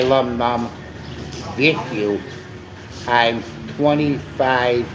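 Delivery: ordinary talk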